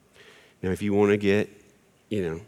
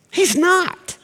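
squeaky punchline; 5 dB louder than usual. Acted. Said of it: "deadpan punchline".

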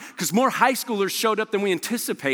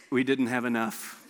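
low, soft, defeated admission; deflated; 10 semitones lower, 5 dB quieter than usual. Retold or imitated. Student pastor admitting the food ran out, low and deflated. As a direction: let it drop low and soft.

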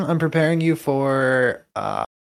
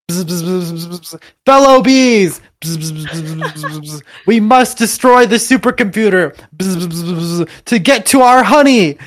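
buzzing bee rallying the swarm, higher and loud; bee leader voice; 3 semitones higher, 9 dB louder than usual. Acted. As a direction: rouse the bees like a tiny general. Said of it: "Barry commands them".

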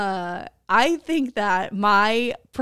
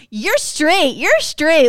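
sing-song playground tease; flipping the playground taunt; mock taunt; 4 semitones higher, 5 dB louder, much slower than usual. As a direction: taunt like a schoolyard kid. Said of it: "you should be going".

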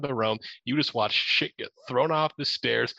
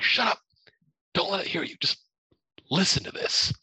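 urgent scared hiss; 8 semitones higher than usual. Retold or imitated. Hushing owner near the door.

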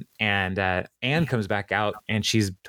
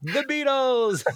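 mock yell; slower than usual. Ben heckles the band with an answer, high mock yell. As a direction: call it out high and stretch it. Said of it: "Ben yells out".

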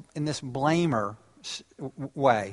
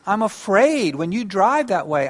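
calm I statement; 6 semitones higher, 4 dB louder, slower than usual. Shown as a demonstration. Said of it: "use an I statement that's less threatening".

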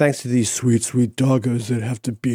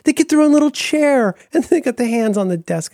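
higher delighted gushing; cooing at dogs; 11 semitones higher than usual. Real, not acted. Looking at dog photos with Dan, higher delighted gush. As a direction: gush over the cute pictures.

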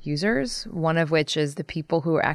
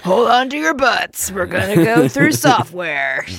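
hammed-up dramatic line; 3 semitones higher, 6 dB louder than usual. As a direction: ham it up like an action movie. Joking movie voice.

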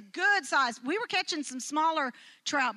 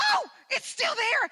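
excited; 9 semitones higher than usual. Retold or imitated. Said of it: "and I'm like".

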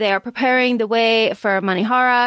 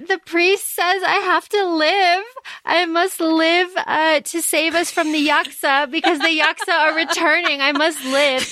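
higher, lightly voiced solemn vow; earnest Malini; 6 semitones higher than usual. Malini claiming the priest told her to live, lightly voiced solemn vow. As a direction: declare it like a vow to survive. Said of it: "And Malini says".

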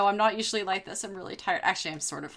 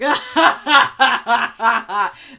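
loud evil chuckle; anime villain laugh; 4 semitones higher, 13 dB louder than usual. Retold or imitated. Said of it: "like, anime villain laugh".

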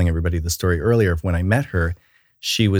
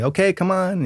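put-on teacher rallying kids; 11 semitones higher than usual. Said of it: "You need to walk in and say".

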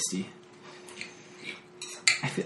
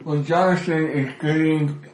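chewing into mic; 2 semitones higher than usual. Real, not acted. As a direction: mouth full, close to the mic. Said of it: "I'm gonna chew this into the microphone".

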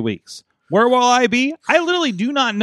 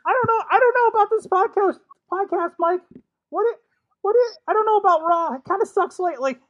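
put-on much higher, stammering nervous teen voice; Morty voice. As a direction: stutter it out in a jittery high pitch. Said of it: "your Morty voice sucks".

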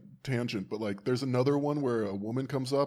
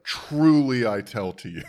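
loud, drawn-out catty TRULY; hammed-up catty pronouncement, slow and forceful; 8 dB louder, slower than usual. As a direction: stretch the first word with mock exasperation. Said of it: "I do appreciate Jesus's catty".

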